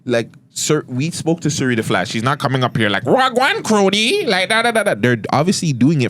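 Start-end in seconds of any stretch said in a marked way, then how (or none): 3.0-4.9 hammed-up Toronto Mandem accent, pitched up high; mock the over-the-top Toronto slang voice; exaggerated Toronto accent. Host mimics exaggerated Toronto rappers in a high mock Toronto accent. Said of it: "the way those guys do it is exaggerated".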